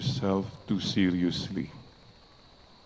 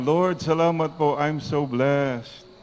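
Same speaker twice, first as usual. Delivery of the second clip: louder and higher, with conviction; loud, higher, slow grateful declaration; more convincing; 5 semitones higher, 5 dB louder, slower than usual. Shown as a demonstration. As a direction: say it like you mean it. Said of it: "parang hindi convincing. One more time".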